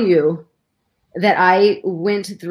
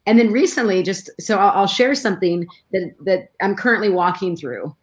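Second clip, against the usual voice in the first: normal talking voice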